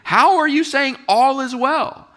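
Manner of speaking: hammed-up outrage at her answer; incredulous. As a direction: react like you can't believe her. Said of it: "I was like".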